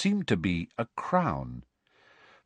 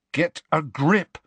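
slow, firm and raised; stern; 7 semitones higher, slower than usual. A narrator's reading. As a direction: lay down the law, slowly. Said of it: "said Harry sternly".

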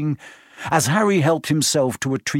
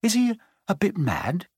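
hesitant, unsure question; uncertain; 8 semitones higher than usual. Acted. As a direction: ask it half-doubting. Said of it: "he asked Percy uncertainly".

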